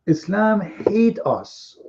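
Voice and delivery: ordinary talk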